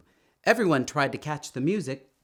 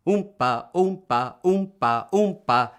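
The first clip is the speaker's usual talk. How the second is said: imitating a tuba, climbing higher and louder each time; tuba oompa; 5 semitones higher, 5 dB louder than usual. A narrator's reading. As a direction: oompa like a brass horn, building up. Imitating the big horn.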